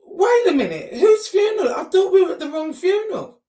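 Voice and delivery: high, loud, shocked questioning; outraged confusion, voice up high; shocked disbelief. Retold or imitated. Retold to listeners, daughter realising it's the wrong funeral, shocked and loud. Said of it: "And I'm like".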